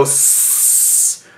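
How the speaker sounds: long hissing "s-" like a snake; drawn-out s like a snake. Shown as a demonstration. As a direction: stretch the "s-" into a snake hiss. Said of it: "like a weeb snake".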